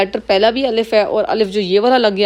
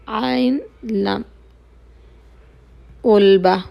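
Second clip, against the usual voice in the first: ordinary delivery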